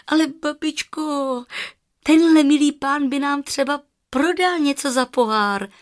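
put-on high, loud little-girl protest; eager high-pitched childish call. A narrator's reading. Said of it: "volala Ela".

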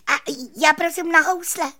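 very high, quick, loud chirp; squeaking cricket. A narrator's reading. Cricket introducing his instrument, high quick squeak. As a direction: pipe up in a tiny squeak. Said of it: "kníkl Cvrček".

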